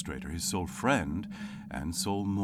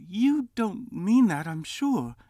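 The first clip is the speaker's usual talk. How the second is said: put-on high, louder, coaxing young voice; cheerful nephew; 7 dB louder than usual. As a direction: coax him kindly. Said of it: "Scrooge's nephew".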